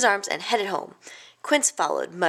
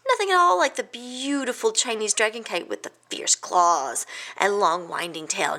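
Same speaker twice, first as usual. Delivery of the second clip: plain reading voice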